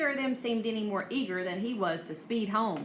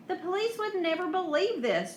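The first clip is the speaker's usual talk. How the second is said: higher-pitched groan of disbelief; 8 semitones higher than usual. A narrator's reading. Chip doubting the police would believe them, groaning. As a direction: groan it out, raised pitch, mildly exasperated. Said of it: "Chip groaned".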